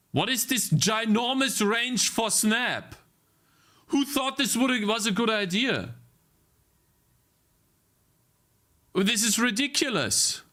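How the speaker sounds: high-pitched, incredulous complaint at the software; exasperated rant. Real, not acted.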